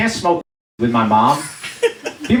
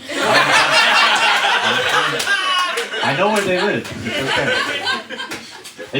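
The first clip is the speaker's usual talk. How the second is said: drawn out, much higher, creepy and slow; hammed-up Nazi detective; 11 semitones higher, much slower than usual. Son promising mom to find a man, as a hammed-up sinister detective. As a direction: stretch it like a menacing villain.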